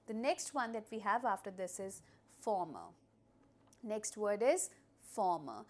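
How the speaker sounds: ordinary delivery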